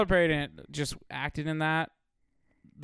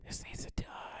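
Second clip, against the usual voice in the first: whisper; 16 dB quieter than usual. Retold or imitated. Mock relief at Madea ending.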